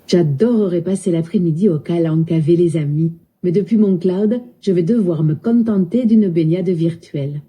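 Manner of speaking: lilting southern French accent. Shown as a demonstration. Voice assistant obliging a user, in southern French accent. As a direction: imitate a Midi accent, sounding final vowels. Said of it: "parle avec l'accent du sud".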